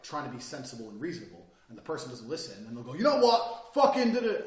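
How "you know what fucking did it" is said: loud, high outburst; screaming to intimidate. Retold or imitated. Intimidator losing patience with a listener, screaming. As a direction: imitate someone suddenly blowing up. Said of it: "people intimidate by screaming because they start low and then they go high".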